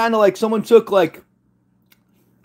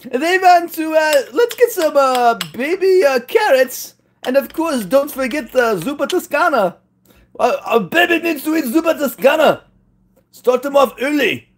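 much higher hammed-up vague European accent as an Italian parent; mock foreign accent; 9 semitones higher than usual. Imitating Italian parents feeding the new baby, silly high mock accent. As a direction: play a silly made-up accent, pitched way up. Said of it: "what kind of accent is this?".

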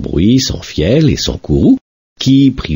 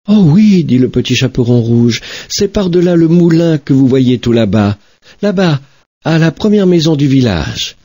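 put-on high, eager little girl pointing the way; bright child pointing far off; girl's voice; 9 semitones higher than usual. Little girl showing the wolf the road, high and eager.

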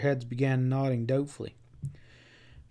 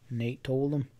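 ordinary delivery, nothing marked

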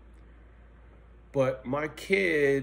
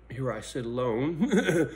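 ordinary talk, nothing marked

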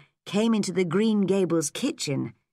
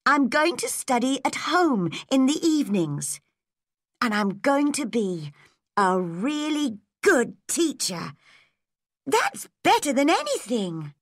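Anne, cheerful and determined; 7 semitones higher than usual. Acted. Anne continuing her plan.

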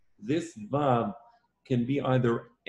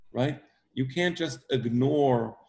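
ordinary talk, nothing marked

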